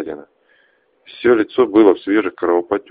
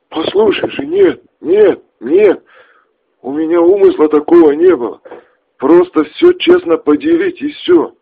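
pleading; 7 dB louder than usual. Acted. Max pleads with gunman.

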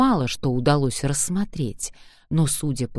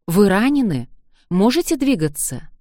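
anxious, worried; 6 semitones higher, 6 dB louder than usual. Acted. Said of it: "В его дрожащем голосе звучала тревога".

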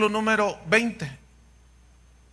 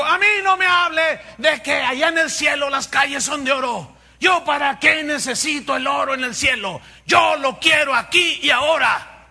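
loud, higher shouted boast; mock prosperity preacher; 4 semitones higher, 5 dB louder than usual. Preacher imitates a famous preacher demanding gold now, shouted. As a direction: shout it like a brash televangelist.

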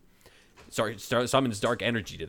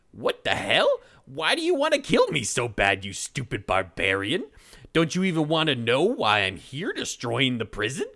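indignant witch; 4 semitones higher, 5 dB louder than usual. Acted. Witch angrily questions Braun.

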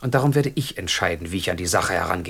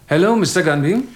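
phone greeting; 8 semitones higher, 7 dB louder than usual. Acted. Answering strange phone call.

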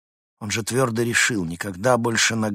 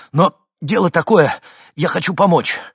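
loud, raised-pitch appeal; urgent pleading; 9 semitones higher, 11 dB louder than usual. Acted. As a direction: plead loudly to be believed. Запыхавшийся мужчина убеждает испуганную женщину. (громко, настойчиво убеждая)

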